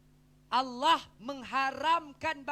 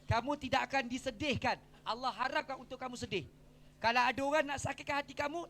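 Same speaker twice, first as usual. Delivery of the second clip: normal talking voice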